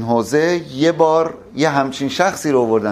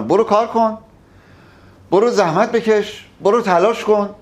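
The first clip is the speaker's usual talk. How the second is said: bossy orders; 6 semitones higher than usual. Retold or imitated. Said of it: "یه نفر بشینه اون بالا، همش امر و نهی کنه".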